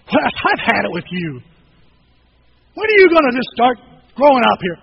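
loud fed-up telling-off; hammed-up scolding, building to a shout; mock exasperation. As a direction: act like your patience just ran out. Acts out scolding the friend avoids.

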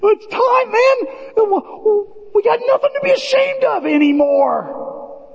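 high, shouted declaration; preaching shout. Real, not acted. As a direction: proclaim it to the back row. Preaching climax on freedom.

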